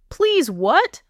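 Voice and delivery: mock-innocent lilt, rising at the end; feigned innocence. A narrator's reading. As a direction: ask it as if you have no idea. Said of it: "my voice innocent".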